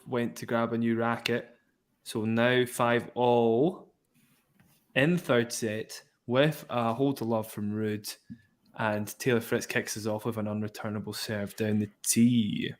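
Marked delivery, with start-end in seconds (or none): 2.0-12.7 slow, drawn-out score call; live play-by-play. Acted. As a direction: let each word of the score land.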